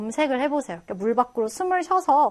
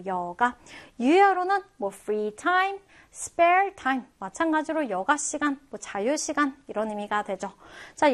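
ordinary delivery

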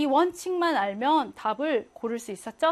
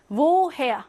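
Woher with a louder, weightier "her"; stress on second syllable; 2 semitones higher, 4 dB louder than usual. Shown as a demonstration. Teacher models the compound adverb for students, stress on the end of Woher. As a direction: hit the back half of Woher, wo-HER. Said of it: "강세가 뒤에 있다".

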